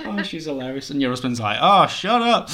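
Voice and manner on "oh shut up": high, loud mock brush-off; teasing shut up. Husband teasing, mock dismissive.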